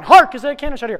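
high, loud, rapid rehearsal; frantic shouted line. Acted. Late actor rehearsing on the dash, frantic shout. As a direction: shout it on the run.

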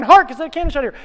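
high, loud, rapid rehearsal; frantic shouted line. Acted. Late actor rehearsing on the dash, frantic shout. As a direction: shout it on the run.

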